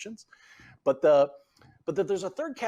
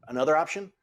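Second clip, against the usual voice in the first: normal talking voice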